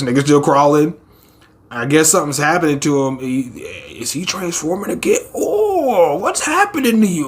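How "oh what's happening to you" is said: high, laughing gasp of worry; hammed-up alarm, laughing through it; high mock-worried voice. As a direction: play it up as the startled prisoner.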